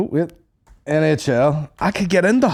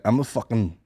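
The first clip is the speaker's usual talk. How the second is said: low, understated big-man act; mock dominant; 6 semitones lower, 6 dB quieter than usual. Telling a friend how to face down dogs, mock dominant. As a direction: play the big man under your breath, pitched low. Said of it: "gotta assert the dominance".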